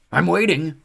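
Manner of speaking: high loud impatient centaur. Acted. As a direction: tap your hoof, impatient. Centaur taps hoof impatiently.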